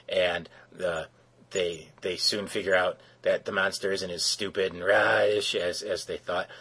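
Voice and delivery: ordinary delivery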